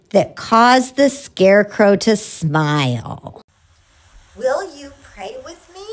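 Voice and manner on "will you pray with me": soft, hushed prayer voice; children's prayer. Acted. Puppet leads children in a soft, hushed prayer. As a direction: pray gently with the kids.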